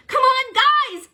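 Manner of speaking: rally the team; shout. Acted. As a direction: gecko shouting to friends. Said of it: "shouted the Great Gecko".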